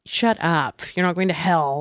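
low, gentle retelling of reassurance; reassuring mother. Retold or imitated. Mother comforts daughter afraid of hell, understated reassurance. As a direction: imitate a mom calmly dismissing the fear. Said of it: "the first thing my mother said was like".

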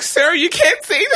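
high, squeaky protest through laughter; scandalised high-pitched objection, cracking up. Retold or imitated. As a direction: plead in a high voice, half laughing. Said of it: "have to say it in that voice".

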